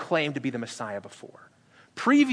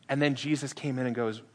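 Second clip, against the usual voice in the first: normal talking voice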